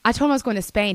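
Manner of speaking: ordinary talk, nothing marked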